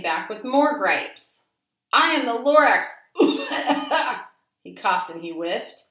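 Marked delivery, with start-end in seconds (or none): 1.9-2.9 loud declaration. A narrator's reading. Lorax announces himself again. 2.9-4.3 laugh. A narrator's reading. Laugh alone on line.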